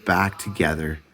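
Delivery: ordinary delivery, nothing marked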